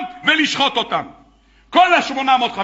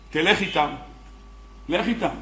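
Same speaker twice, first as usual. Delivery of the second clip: soft coaxing; 5 semitones lower, 9 dB quieter than usual. Acted. Elijah coaxing the bull.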